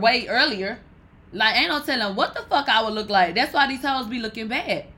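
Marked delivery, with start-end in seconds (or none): none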